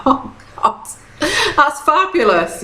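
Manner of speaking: light laugh, pitch up; delighted, laughing partner; laughing along at a partner's nose picking. Retold or imitated. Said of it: "and it's like".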